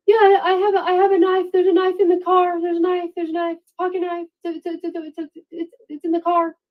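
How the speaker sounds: high, stammering mock panic; a hint of a frightened man stuttering; imitating panic. Suspect telling police about the knife, mock panic. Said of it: "he's just freaking out".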